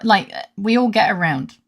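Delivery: normal talking voice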